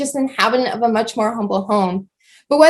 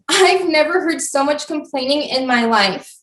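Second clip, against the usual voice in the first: usual speaking voice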